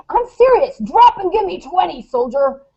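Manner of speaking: hammed-up sergeant order to her son; army sergeant voice. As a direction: double down like a playful drill sergeant. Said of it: "in my best Army Sergeant voice".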